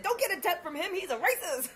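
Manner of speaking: put-on high, loud call to a passing girl; loud warning. Dancer warning coworker about customer, loud high. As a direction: warn her across the room. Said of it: "I said".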